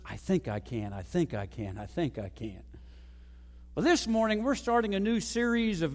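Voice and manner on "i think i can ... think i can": low, soft, steady self-repetition; quiet low chant to yourself; repeating to oneself. Acted. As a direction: murmur it low and softly, over and over. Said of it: "repeating to itself the words".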